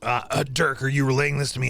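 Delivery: usual speaking voice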